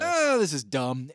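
drawn-out, slow, loud groan of contempt; mock disgust. Telling co-host about quitting wrestling, slow loud scoff. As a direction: sneer it like it's beneath you. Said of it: "I was like".

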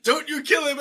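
high-pitched imitated plea; mock protective cry. Man warns the mob off the boy, mock urgency. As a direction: play the man calling over the crowd.

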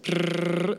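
rolled-lip imitation of a phone ring; mock ringing. Traveler mimics the strange Australian phone tone, rolled buzz. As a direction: buzz your lips like an odd ring tone.

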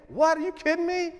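high, incredulous "are you kidding me"; mock disbelief. Preacher scoffing at the congregation, high and incredulous. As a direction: toss it off as if the idea is absurd.

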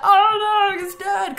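high, loud hammed-up cry of dismay; mock distress. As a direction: overplay the shock of finding him dead. Daughter grieving in retelling.

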